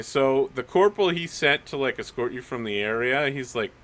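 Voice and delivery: ordinary talk, nothing marked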